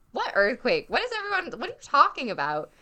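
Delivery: disbelieving and confused; baffled surprise. Retold or imitated. Narrator reacting to the vineyard's earthquake email, startled and confused. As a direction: pitch jumps high on the second what. Hears of an earthquake she missed.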